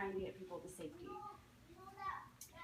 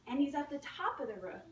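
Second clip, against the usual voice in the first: ordinary talk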